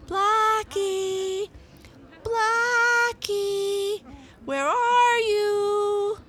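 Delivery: high, loud, drawn-out call. A narrator's reading. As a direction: call a lost cat across the platform, stretching the words.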